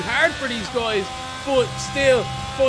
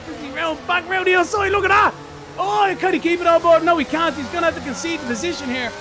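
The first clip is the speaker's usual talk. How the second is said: rising excitement, louder and higher; excited commentary; 5 semitones higher, 4 dB louder than usual. Real, not acted. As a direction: call the spin like it's live. Car spins, live commentary.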